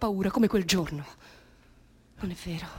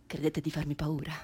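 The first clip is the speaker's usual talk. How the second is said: normal talking voice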